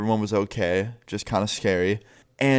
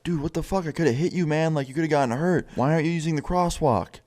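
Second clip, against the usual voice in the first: higher-pitched retold exasperation; incredulous scolding; 6 semitones higher than usual. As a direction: imitate a friend scolding a careless pedestrian. Friend confronts pedestrian from car window.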